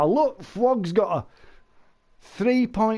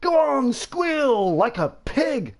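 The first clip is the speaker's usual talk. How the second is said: higher, slow mocking taunt with a laugh; 9 semitones higher, slower than usual. Friend taunting the imagined victim, high laughing taunt.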